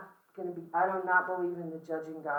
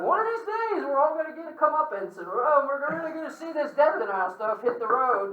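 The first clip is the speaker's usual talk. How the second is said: much higher, louder, gleeful mock voice; mock excited prediction; 9 semitones higher, 8 dB louder than usual. Death-field colleagues predicting the reckoning, squealing high. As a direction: squeal out the prophecy in mock glee. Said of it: "We were like".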